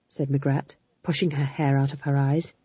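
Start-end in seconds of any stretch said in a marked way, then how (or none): none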